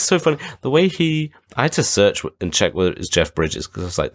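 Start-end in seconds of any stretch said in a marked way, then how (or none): none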